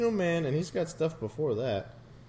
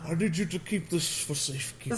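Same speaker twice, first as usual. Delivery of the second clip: put-on a laughing old wizard; Dumbledore voice; 5 semitones higher than usual. Voicing Dumbledore.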